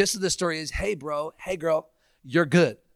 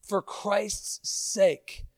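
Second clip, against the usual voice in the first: drawn-out plea; 4 semitones higher, much slower than usual. Acted. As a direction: stretch each word as a gentle plea. Repeated refrain.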